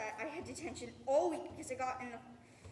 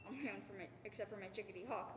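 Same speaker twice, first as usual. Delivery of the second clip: ordinary talk, nothing marked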